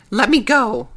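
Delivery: loud, high yell. Acted. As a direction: yell it as you thrash free. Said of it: "I yelled".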